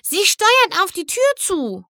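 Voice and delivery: high little foal voice; high, delicate squeal; squeaky foal voice. A narrator's reading. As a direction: pipe up like an excited foal. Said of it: "quietschte Sweetie Belle mit ihrer zarten Fohlenstimme".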